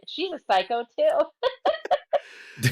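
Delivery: high, slow, drawn out with laughter; hammed-up laughing verdict; amused mock outrage. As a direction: read it out scandalised and laughing. Reads out own outraged movie notes.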